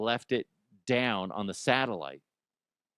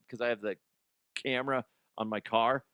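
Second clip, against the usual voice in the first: ordinary delivery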